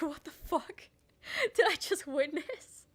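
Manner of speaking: laughing through slow words; laughing disbelief. Real, not acted. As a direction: barely get it out for laughing. Recalling disbelief, laughing while speaking.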